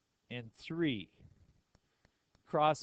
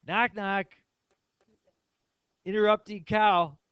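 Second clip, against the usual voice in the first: high, loud knock-knock setup; excited child; 8 semitones higher, 11 dB louder than usual. Retold or imitated. Daughter telling dad her favorite joke, excited. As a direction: imitate an eager kid telling a joke. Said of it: "she's so excited".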